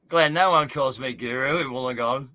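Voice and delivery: normal talking voice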